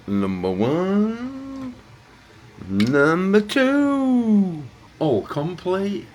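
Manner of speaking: high, slow, stretched-out call of each number; drawn-out announcement. Acted. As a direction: announce each finished car like a game-show host. Triumphant announcing of finished builds.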